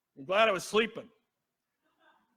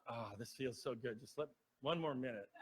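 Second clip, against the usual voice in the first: much softer and lower, half asleep; drowsy murmur; 7 semitones lower, 13 dB quieter than usual. Retold or imitated. Patient begging the nurse for one more minute, soft and sleepy. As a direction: sink back into sleep, barely voiced.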